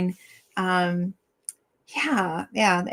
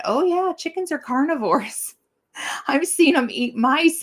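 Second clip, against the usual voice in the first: higher, bubbling with laughter; laughing; 7 semitones higher than usual. Real, not acted. Telling her son chickens eat mice, laughing. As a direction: laugh through the answer.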